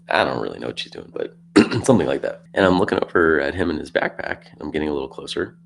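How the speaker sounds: ordinary talk, nothing marked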